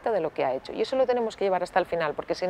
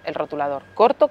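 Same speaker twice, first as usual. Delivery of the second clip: usual speaking voice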